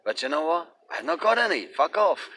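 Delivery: high mock voice, then a dismissive fuck off; mocking brush-off. Narrator brushing off the weed buyer, hammed-up high. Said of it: "some guys come up to me".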